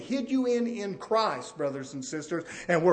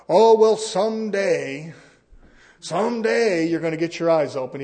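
mock dismissive reply; 7 dB louder than usual. Preacher voicing what Jesus did not say, mock dismissive. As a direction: put on a hammed-up brush-off, stretching the opening.

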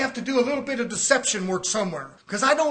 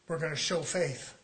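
usual speaking voice

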